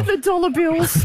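laughing it out, slow; giggling over the words; real laugh through the phrase. Real, not acted. Joking about dollar bills.

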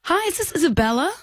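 high-pitched put-on professional phone voice; Claire Martin. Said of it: "taste of your Claire Martin voice".

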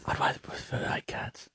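unvoiced, very soft whisper; barely-there whisper; whispered aside. Real, not acted. Low aside answering her cat line.

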